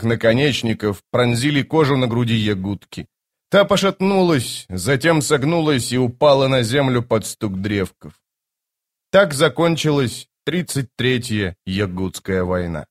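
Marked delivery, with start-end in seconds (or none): none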